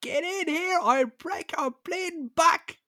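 high-pitched mock-furious Dublin mother; hammed-up high maternal fury; angry Dublin ma. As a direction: screech a threat like an angry ma. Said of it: "if my ma got angry with me".

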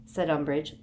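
ordinary delivery

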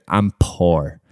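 low, deadpan retort. Retold or imitated. Telling a stripper he has no money, deadpan. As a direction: say it dead serious, low-pitched. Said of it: "looked her dead in the eye and said".

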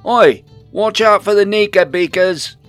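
loud, high shouted warning; calling after them. A narrator's reading. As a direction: yell a warning after people running off. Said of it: "he called after us".

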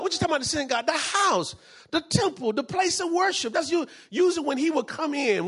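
ordinary talk, nothing marked

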